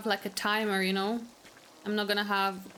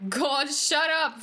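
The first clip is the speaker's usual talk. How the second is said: exasperated; 3 semitones higher, 4 dB louder, much slower than usual. Real, not acted. Annoyed at game character.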